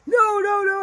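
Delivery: high, loud frantic protest; imitated carrying on. Husband protesting as his middle name nears, frantic. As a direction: shout it down in a high voice. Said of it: "he's carrying on".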